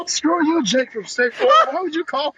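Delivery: high-pitched, indignant comic retort; mock outrage. Acted angry retort to car voice.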